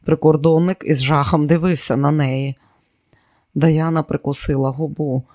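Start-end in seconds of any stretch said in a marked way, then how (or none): none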